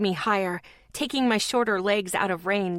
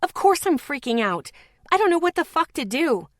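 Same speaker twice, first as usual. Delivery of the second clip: high, loud, building outburst; vehement yell; 7 semitones higher, 4 dB louder than usual. Acted. Lexi yells at Zeke, high and loud in despair. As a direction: yell it out in desperate anger. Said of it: "I yelled vehemently".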